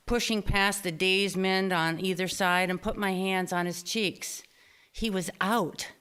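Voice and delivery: ordinary talk, nothing marked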